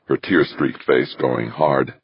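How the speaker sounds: ordinary delivery, nothing marked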